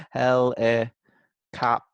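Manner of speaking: "Hel", "eh", "cop" as separate beats; syllable by syllable. Shown as a demonstration. Teacher finds the next syllable to spell, part by part. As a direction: say each part of helicopter apart. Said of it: "each syllable one part at a time".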